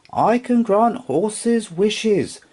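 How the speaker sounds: high and slow magical voice; slow high unicorn. Acted. Unicorn announcing to the horse, high and slow. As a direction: draw it out like a magic creature.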